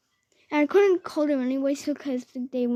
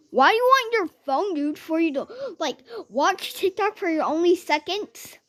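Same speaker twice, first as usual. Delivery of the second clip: higher, louder acted retort; scornful challenge; 6 semitones higher, 5 dB louder than usual. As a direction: challenge the kid incredulously, loudly. Intruder mockingly argues in retold quarrel.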